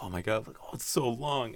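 hushed, rising marvel; mock amazement. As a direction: imitate someone softly awestruck, lifting the pitch. Voices film character marveling at hallway.

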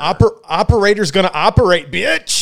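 loud, high-pitched mock swagger; whooping, cocky trash talk; crowing boast. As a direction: crow it out like a playful catchphrase. Crowing catchphrase while showing off knife.

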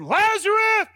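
commanding shout into the grave; thundering call. Acted. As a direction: call the dead man out of the tomb. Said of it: "his voice thundered out".

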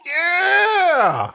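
high, shouted Aussie yeah; a hammy drawn-out yeah; bad Australian accent. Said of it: "a really bad Australian accent".

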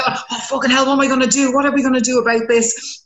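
high-pitched re-enacted fluster; mock exasperated panic, held high; passionate fretting. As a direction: act out getting worked up, voice pitched high. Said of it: "when you get passionate about something".

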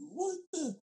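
very high mock disbelief; incredulous high-pitched yelp; retold astonishment. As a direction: squeak out a baffled reaction. Retelling own shock at lady's answer.

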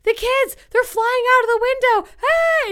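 very high, loud frantic cry; hammed-up officer screaming into a call; mock panic. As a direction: build to a panicked shriek.

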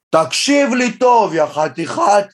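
slow, high, loud furious shout; mayor yelling. Retold or imitated. The offended mayor screaming at the comedian on the phone, loud and slow. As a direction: bellow it at him like an enraged mayor. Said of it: "מתחיל לצעוק עליי בטירוף".

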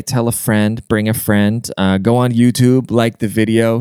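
ordinary talk, nothing marked

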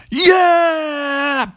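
high, drawn-out whoop; hammed-up dinosaur yell of joy; triumphant shout. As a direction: cheer like a T-Rex breaking loose. T-Rex celebrates power cut.

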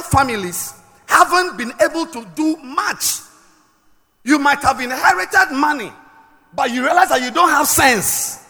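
loud, emphatic preacher delivery; forceful preaching. Acted. Preacher teaching on Elisha's two fathers, forceful preaching. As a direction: drive each phrase home to the congregation.

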